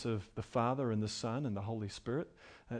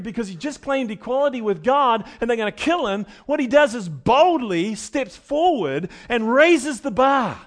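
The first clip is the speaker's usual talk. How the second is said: loud, building sermon delivery; rising pulpit intensity; full-force preaching; 9 semitones higher, 11 dB louder than usual. Acted. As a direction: preach it out to the congregation.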